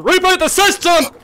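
yelling high and loud in panic; panicked shout. Real, not acted. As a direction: shriek it as things go wrong. Panicking as the monster appears.

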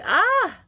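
high, loud "ah" outburst; sudden cry out. Real, not acted. Reader overwhelmed by crazy energy, crying out. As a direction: let out a sharp, high cry.

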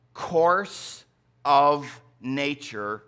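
slowly stretched "course of nature"; drawn-out phrase. Acted.

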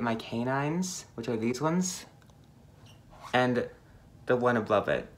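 lip stretched sideways; finger pulling lip. Real, not acted. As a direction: mouth held open by finger. Seen 1: Finger hooks mouth corner to show braces while speaking.

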